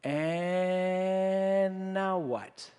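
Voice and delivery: very slow, stretched-out question; a disciple's slow, baffled question; bewildered drawl. Retold or imitated. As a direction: drag the words out in puzzled wonder. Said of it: "I'd be going".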